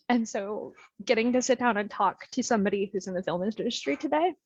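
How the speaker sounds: usual speaking voice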